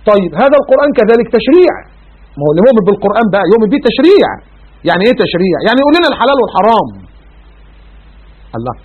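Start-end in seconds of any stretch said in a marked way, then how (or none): none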